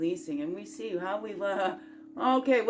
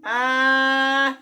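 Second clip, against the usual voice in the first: delighted cry; 3 semitones higher, 9 dB louder than usual. Real, not acted. Delighted exclamation at the cards.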